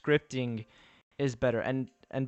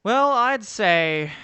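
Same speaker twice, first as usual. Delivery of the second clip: pompous voice; 8 semitones higher, 10 dB louder, much slower than usual. Pretend. Answering chat in a pompous voice.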